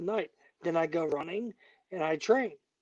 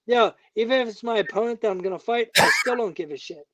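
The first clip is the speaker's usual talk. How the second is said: loud, higher, fired-up rant; angry loud raised rant; heated rant; 3 semitones higher, 8 dB louder than usual. Real, not acted. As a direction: vent it hard at the room. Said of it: "most heated guest ever".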